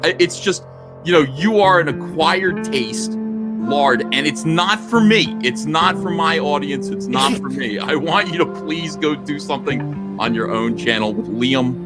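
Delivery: usual speaking voice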